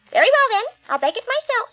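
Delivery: high, firm little hen; determined hen. Acted. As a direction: do it herself, determined.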